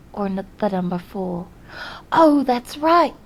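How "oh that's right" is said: loud, high burst of remembering; oh, it just came back to me; sudden realization. Real, not acted. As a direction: blurt it out as it clicks.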